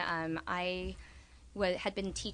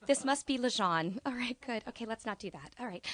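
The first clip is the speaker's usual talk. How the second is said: ordinary talk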